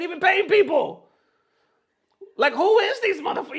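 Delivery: high, loud outraged call-out; outraged shout. Real, not acted. Streamer shouts at the org that skips paying players, outraged. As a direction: yell it in disbelief at the org.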